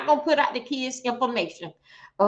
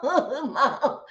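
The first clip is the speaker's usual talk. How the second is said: laughing mama. Retold or imitated. Laughing while mimicking.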